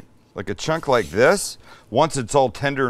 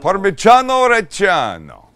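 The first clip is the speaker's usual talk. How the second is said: Parmigiano Reggiano with hammed-up Italian vowels, louder; exaggerated Italian accent; 5 semitones higher, 7 dB louder than usual. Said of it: "that kills me".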